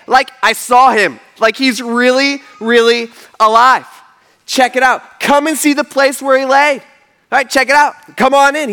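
excited angel. Acted. Angel inviting followers into the tomb, excited insistence. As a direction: press them eagerly to come look. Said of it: "just kinda going crazy".

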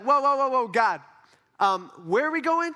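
loud, high interruption; questioning Jesus. Acted. Jesus stopping God to ask where they're going, alarmed. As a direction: halt someone in mock alarm, then query. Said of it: "I would have some questions".

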